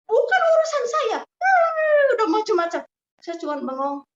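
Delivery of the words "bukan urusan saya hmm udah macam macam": very high, louder retold outburst; hammed-up indignant yelling; mock angry shout. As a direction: imitate a husband yelling in protest. Husband's angry outburst acted again.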